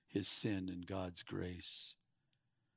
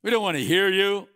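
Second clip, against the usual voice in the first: dismissive crowd; 8 semitones higher, 13 dB louder than usual. Pretend. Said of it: "They're gonna go".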